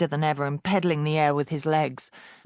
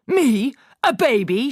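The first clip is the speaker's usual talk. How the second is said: higher, louder indignant shout; outraged shout; 7 semitones higher, 6 dB louder than usual. A narrator's reading. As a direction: bellow it in disbelief. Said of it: "shouted the Trunchbull".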